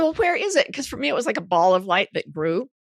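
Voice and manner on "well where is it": eager question. Retold or imitated. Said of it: "And I said".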